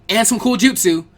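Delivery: put-on boy blurting in eagerly; loud cut-in. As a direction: blurt it out over the grown-ups. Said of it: "Naruto cut in loudly".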